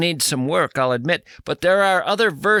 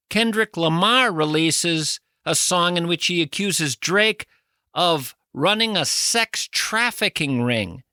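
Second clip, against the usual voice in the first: ordinary delivery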